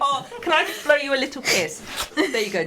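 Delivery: high, touched and half laughing; emotional, high-pitched affectionate laugh; getting emotional. Real, not acted. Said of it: "I'm getting all emotional".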